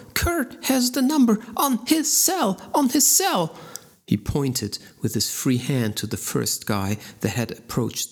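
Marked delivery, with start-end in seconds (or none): 0.1-3.6 high, louder pained cry; a thug screaming as his finger is twisted. A narrator's reading. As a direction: shriek it out, desperate to make her stop. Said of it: "The man screamed".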